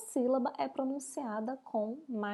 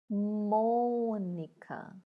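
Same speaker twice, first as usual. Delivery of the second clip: "Mônica" with a long, strong MÔ; stressed first syllable; 4 dB louder, much slower than usual. Shown as a demonstration. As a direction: call out "Mônica" very slowly, holding MÔ. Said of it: "a gente pronuncia com mais entonação". ("Mônica" bem devagar, esticando o MÔ)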